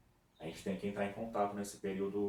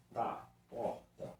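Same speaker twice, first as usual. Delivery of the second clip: drawn-out, half-whispered sounding of the word; teacher sounding out porta slowly; 3 semitones higher, much slower than usual. Shown as a demonstration. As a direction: stretch it syllable by syllable. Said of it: "Vamos ver dos outros".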